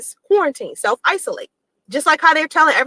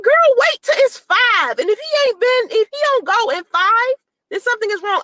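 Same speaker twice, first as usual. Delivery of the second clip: higher, airy nurse impression, breezy and dismissive; mimicking the nurse; 8 semitones higher than usual. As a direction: play the nurse brushing it off, "Girl, please". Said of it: "She said".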